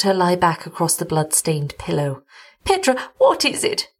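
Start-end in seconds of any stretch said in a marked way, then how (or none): 2.6-3.9 high, pleading and frightened; begging. A narrator's reading. Terrified wife begs her husband to explain, pleading. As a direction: plead with him, much higher. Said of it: "she begged".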